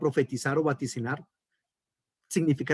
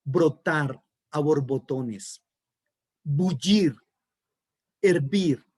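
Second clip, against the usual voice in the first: slow emphasis; much slower than usual. Shown as a demonstration.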